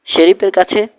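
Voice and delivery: a jolt of disbelief in the voice; surprised question. A narrator's reading. Haven asks Prince, startled, about the sheriff. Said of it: "কিছুটা বিস্মিত haven".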